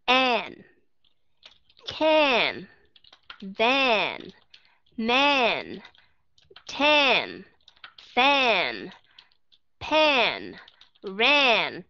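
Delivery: "an, can, van, man, tan, fan, pan, ran" each with a wide-open, E-like vowel; teacher drilling short A words one by one; open-mouth short A drill. Shown as a demonstration. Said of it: "các bạn mở miệng và các bạn đọc".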